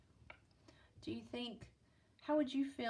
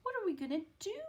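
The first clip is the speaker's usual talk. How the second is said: higher, louder anxious cry; cried out; 8 semitones higher, 5 dB louder, much faster than usual. Acted. Mother panicking over her sick daughter, worried cry.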